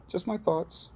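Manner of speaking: squeaky high sign-off, tossed off lightly; high playful voice. Pretend. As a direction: say it high and teasing, like a cartoon.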